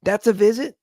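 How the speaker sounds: high, loud, incredulous "That's a visit?"; feeling cheated. Retold or imitated. Son protesting his mom's dream visit, incredulous. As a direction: scoff like you got shortchanged. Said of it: "I'm like".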